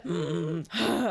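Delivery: imitated heavy sigh; deep breath. Host mimicking the caller's breath, deep sigh. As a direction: draw out one big breathy sigh. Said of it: "like, a deep breath".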